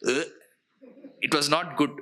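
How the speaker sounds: low, wrinkled-nose grimace of distaste; disgusted. Shown as a demonstration. Teacher acting out distaste to students, low and disgusted. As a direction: say it like something smells off. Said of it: "Now you'll frown with your nose".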